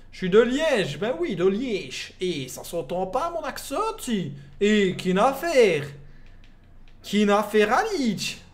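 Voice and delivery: hammed-up Liège accent, drawn-out vowels, swooping pitch; bad Liège accent. Streamer insisting to a viewer he is from Liège, mock Belgian accent. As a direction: put on a broad, clumsy Belgian accent from Liège. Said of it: "l'accent belge, on va pas se mentir, il est pas ouf".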